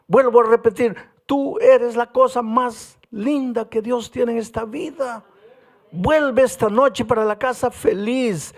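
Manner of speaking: fervent preacher pressing the point home; preaching at full force. Acted. Preaching the climax.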